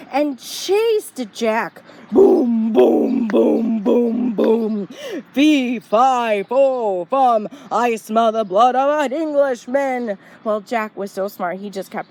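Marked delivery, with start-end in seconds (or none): 2.1-4.9 loud booms fading away; booming footsteps. A narrator's reading. Giant chases Jack. 5.3-10.2 the giant sniffing out Jack, booming; giant's voice. A narrator's reading. Giant speaks again.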